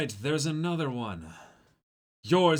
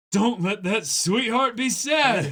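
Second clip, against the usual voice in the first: high, loud, slow laughing; hammed-up self pep talk; 9 semitones higher, 9 dB louder than usual. Sanji forcing himself to be cheerful. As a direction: psych yourself up over the top.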